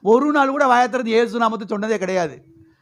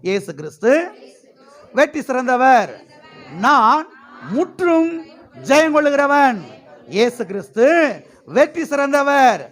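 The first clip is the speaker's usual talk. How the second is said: high, ringing phrases with pauses to repeat; rallying victory declaration; 4 semitones higher, 3 dB louder than usual. Real, not acted. Preacher leads church with hands raised, ringing victory declaration. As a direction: lead the crowd in short triumphant bursts.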